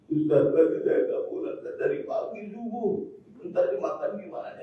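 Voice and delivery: high-pitched, imitated tearful complaint; mock tearful wife. Worried wife complains to the ustaz about her missing husband, mock tearful. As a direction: whimper it in mock distress, high. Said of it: "dia nangis, nangis".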